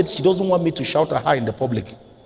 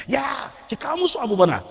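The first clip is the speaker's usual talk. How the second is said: retold shout; 10 semitones higher than usual. Preacher re-enacts shouting at his wife, mock yelling. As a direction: imitate a shout, pitched right up. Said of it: "And I'm shouting".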